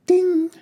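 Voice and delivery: high, loud bell-like ding. Acted. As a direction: ring it out like a struck bell. Sound of tool striking.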